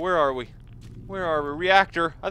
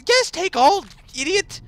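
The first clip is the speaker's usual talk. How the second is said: gleeful cry; 13 semitones higher, 6 dB louder than usual. Real, not acted. Mocking the game.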